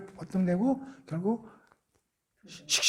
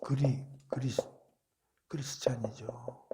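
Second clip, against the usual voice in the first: whisper; 6 semitones lower, 7 dB quieter, slower than usual. Real, not acted. Aside answer.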